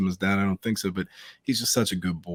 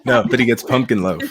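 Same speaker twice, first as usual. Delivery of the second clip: laughing; 6 semitones higher, 7 dB louder than usual. Real, not acted. Laughing while joking about son.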